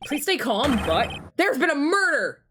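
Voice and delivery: hammed-up breaking of bad news; acted urgent alarm. Lawyer warns girl of murder, urgently.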